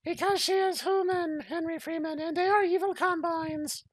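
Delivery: high falsetto mother's voice; mock mother in falsetto; lady's voice. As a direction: play the mom in a high put-on voice. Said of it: "Henry Freeman's mom said back".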